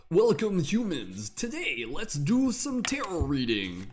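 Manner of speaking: jokey high-pitched channeling voice that drags out its last words; Bashar impression. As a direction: ham up a channeled entity greeting humans. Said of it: "my Bashar voice for channeling".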